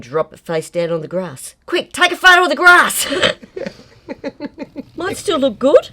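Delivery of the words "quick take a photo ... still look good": high, loud mock-panicked order; urgent retold call. Painter retelling dropping work on the grass, urgent mock yell. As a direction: re-enact a hurried shout for a photo.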